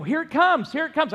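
high, loud eager calling out; dad spotting the boat, calling out excitedly. Retold or imitated. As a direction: sing out the waiting for the boat. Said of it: "And I go".

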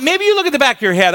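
usual speaking voice

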